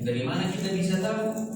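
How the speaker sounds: normal talking voice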